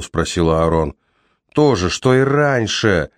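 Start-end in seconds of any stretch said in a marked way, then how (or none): 1.5-3.1 higher, slower rising shout. Acted. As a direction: break into a shout. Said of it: "перешёл на крик Кол".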